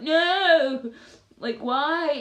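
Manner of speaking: loud, high mock wail of protest; dismayed outcry. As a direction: cry out at losing subscribers. Acts out dismay at lost subscribers.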